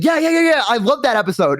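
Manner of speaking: high, gushing yeahs; an eager fangirl's gush; excited fan girl. Retold or imitated. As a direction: gush about a favorite episode. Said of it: "And she's like".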